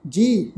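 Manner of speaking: ਜੀ with a long, drawn-out "ee"; lengthened vowel. Shown as a demonstration. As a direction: stretch the "ee" of ਜੀ. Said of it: "ਬਿਹਾਰੀ ਦੀ ਮਾਤਰਾ ਲਮਕਾ ਕੇ".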